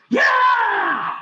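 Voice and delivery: a big high shouted yeah; imitated triumphant scream, loud and high; retold scream. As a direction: scream it right in his face. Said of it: "he screams in my face".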